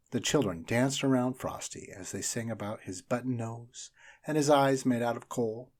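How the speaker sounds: ordinary delivery, nothing marked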